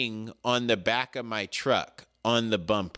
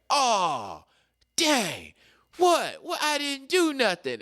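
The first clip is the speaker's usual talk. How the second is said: high, whiny innocent protest; mock-innocent high whine; protesting suspect; 12 semitones higher, slower than usual. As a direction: play the caught guy crying foul.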